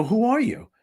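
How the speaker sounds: high-pitched retold astonishment; astonished question. Passenger learns the stranger answers a prayer, astonished question. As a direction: shoot the pitch up in disbelief. Acts out her astonished reply.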